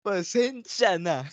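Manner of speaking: high, slow pleading pasensya; mock apology voice. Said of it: "Gagayahin ko".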